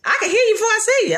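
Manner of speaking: high, fast mock-scold; scolding mama. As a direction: rattle it off like a sassy mother. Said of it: "What she said?".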